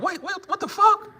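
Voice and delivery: high, frantic disbelief; panicked brother. Pretend. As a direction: back away from the whole thing. Imagined brother protesting.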